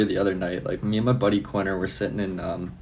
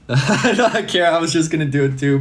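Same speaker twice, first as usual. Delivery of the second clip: laughing; 7 semitones higher, 6 dB louder than usual. Real, not acted. Laughing while protesting.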